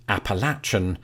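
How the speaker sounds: "Appalachian" with a "latch" in it; old Spanish-era pronunciation. Shown as a demonstration. Explaining the word's history, "Appalachian" said with "latch". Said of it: "transcribed by Spanish explorers as this".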